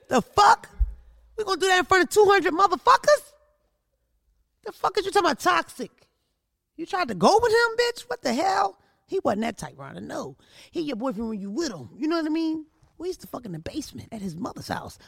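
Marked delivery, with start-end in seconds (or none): 0.1-8.8 high, loud retold outrage; acted-out angry confrontation; indignant telling-off. 13.0-15.0 hushed, whispered aside; softly whispered mid-rant confession; confiding whisper. Retold or imitated. Confides an old secret mid-rant.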